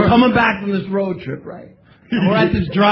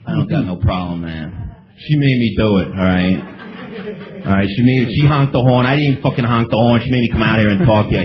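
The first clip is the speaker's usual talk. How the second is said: much lower, hushed defensive pleading; lower, sheepish mumbled excuse; meek excusing husband; 10 semitones lower than usual. Retold or imitated. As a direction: plead your innocence low and small. Meek excuse to angry stranger.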